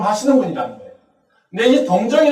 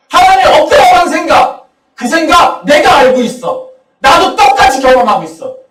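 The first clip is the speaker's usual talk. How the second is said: impassioned preaching; 8 semitones higher, 9 dB louder than usual. Pretend. Jesus voiced answering the believer.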